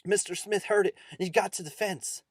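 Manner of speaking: loud, high, urgent climax; urgent narration. A narrator's reading. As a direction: push the rescue attempt high and loud. Climax of the ghost story.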